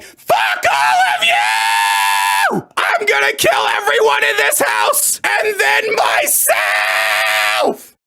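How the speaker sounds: hammed-up explosion of fury from the mother; mock furious outburst. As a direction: son parodying his mother's sudden rage at the whole family. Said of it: "in an instant, she'd be like".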